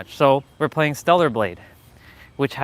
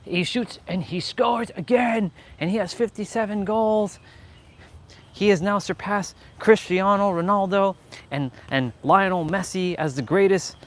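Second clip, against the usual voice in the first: hammed-up play-by-play; mock commentator; 6 semitones higher than usual. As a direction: call it like a hyped sportscaster.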